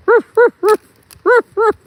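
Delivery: loud, high imitated woofs; high mock barking; dog barking. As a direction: bark in quick bursts like an excited dog.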